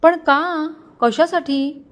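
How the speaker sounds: puzzled, insistent why; surprised questioning. A narrator's reading. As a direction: ask why, pitched well up. Surprised questions about refused gift.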